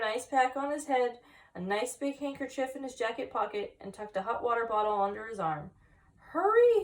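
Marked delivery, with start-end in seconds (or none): 6.2-6.8 high loud urgent shout. A narrator's reading. Friend shouting at slow Wilbur to hurry. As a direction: yell it high, hurry him up. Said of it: "his friend shouted".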